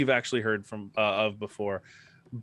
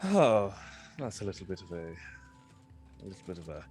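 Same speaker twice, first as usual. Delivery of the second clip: disappointed elf bard; 2 semitones lower than usual. Acted. Elf bard learning nobody knows him, disappointed. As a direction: let the words sink away, let down. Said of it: "you see Slaster kind of go".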